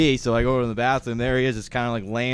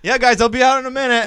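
calling out from behind a door; raised voice through a closed door; 11 semitones higher, 8 dB louder than usual. Retold or imitated. Voicing roommate through bathroom door.

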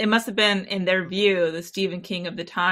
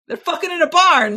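higher and louder, in disbelief; incredulous outburst; 9 semitones higher, 9 dB louder than usual. Real, not acted. Telling her cohost about the barn scene, incredulous outburst. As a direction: blurt it out like you can't believe it.

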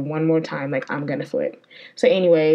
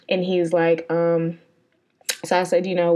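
ordinary talk, nothing marked

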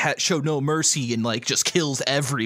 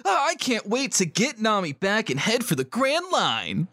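put-on higher, eager boy hero; Luffy voice; 6 semitones higher than usual. Captain dreaming of the Grand Line, eager. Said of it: "playing the various characters".

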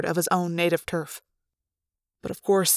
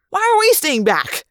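outraged; 8 semitones higher, 6 dB louder than usual. Acted.

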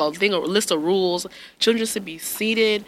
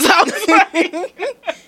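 laughing; 12 semitones higher, much slower than usual. Real, not acted. Laughing while retelling.

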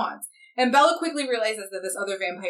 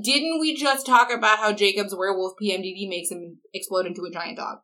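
ordinary talk, nothing marked

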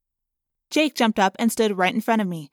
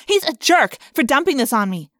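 moderately loud, higher-pitched outburst; raised voice; 4 semitones higher, 6 dB louder than usual. A narrator's reading. Rachel complaining about her dad, loud and indignant. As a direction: blurt it out hotly. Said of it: "I said loudly".

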